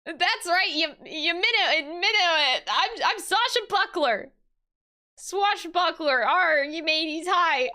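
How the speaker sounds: high, halting hammed-up pirate. Sasha Buckler introducing herself to the lawyers, amateur pirate. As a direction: fumble through a swashbuckling introduction. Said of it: "how do I speak pirate?".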